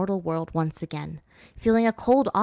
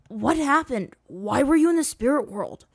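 higher, quick urgent questions; hurried asking; 7 semitones higher, 4 dB louder than usual. A narrator's reading. Zuko pressing Aang on waking, hurried. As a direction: rush the questions out. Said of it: "Zuko asked hurriedly".

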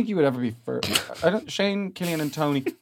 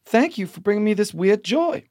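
higher Southern belle voice; Southern accent; 4 semitones higher than usual. Pretend. Host reading listener email as a Southern lady. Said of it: "he's back in character".

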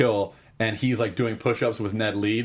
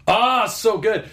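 gushing; 11 semitones higher, 5 dB louder, slower than usual. Real, not acted. Gushing about the scene.

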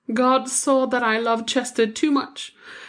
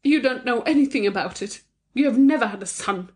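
a cutting, higher retort; bitter mother snapping back at her friend; cruelly; 6 semitones higher than usual. A narrator's reading. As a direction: wound him with the words. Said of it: "said Thyra cruelly enough".

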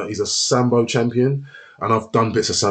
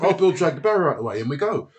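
normal talking voice

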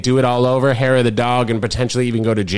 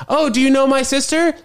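much higher, chirpy quote; bubbly retold greeting; 11 semitones higher than usual. Girl greeting friends about her sister, bubbly. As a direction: pitch it up like an eager introduction.

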